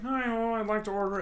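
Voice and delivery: high, goofy puppet voice ordering food; silly high puppet voice; Muppet ordering voice. Retold or imitated. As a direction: play a cheerful Muppet at the drive-thru window. Muppet voice at the drive-thru.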